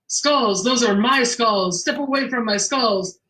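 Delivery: haunt them slowly as a talking machine; spooky fortune-telling machine. Retold or imitated. Hidden detective freaking out forensics team through machine, spooky voice. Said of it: "And the machine says".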